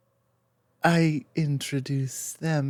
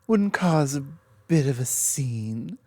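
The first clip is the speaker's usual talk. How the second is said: normal talking voice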